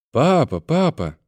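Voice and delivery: put-on high, drawn-out child's call; slow, high-pitched girl's voice; little girl calling. A narrator's reading. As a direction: call for daddy from another room. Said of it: "донёсся из комнаты голос девочки".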